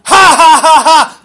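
loud, high jeering laugh; mocking laugh. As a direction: jeer at the fleeing king. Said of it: "he curses him, going".

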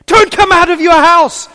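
loud, high frantic yell; mock panicked shout. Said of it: "she would have said it in a gentle, lovely, calm tones, but".

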